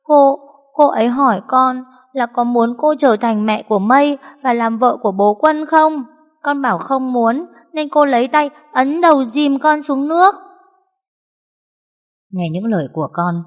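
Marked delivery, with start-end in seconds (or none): 0.0-10.3 put-on high, upset little girl telling her mother; child's voice. A narrator's reading. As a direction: speak up high like a frightened child. Child telling mother what happened. (giọng bé gái hoảng hốt)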